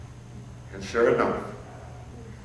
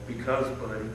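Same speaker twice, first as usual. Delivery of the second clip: softer, tender promise with a smile; smiling reassurance; 8 dB quieter than usual. Retold or imitated. Grown man promising his child self a good future, softly smiling. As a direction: soothe the little boy, hushed and sure. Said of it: "And I smiled and said".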